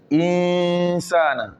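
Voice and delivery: Insana slow, sa stretched long; drawn-out sa. Shown as a demonstration. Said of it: "Le sa est tiré avec un petit alif".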